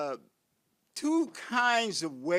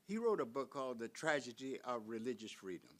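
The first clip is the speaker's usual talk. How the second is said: ordinary talk, nothing marked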